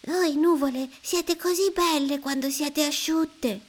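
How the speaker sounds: put-on high child voice praising; admiring child. A narrator's reading. Boy admiring the clouds, high and bright-voiced. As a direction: flatter the clouds. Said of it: "esclama Mido".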